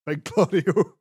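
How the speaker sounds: slow laughing nickname. Real, not acted. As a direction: laugh it out slowly. Joking nickname through laughter.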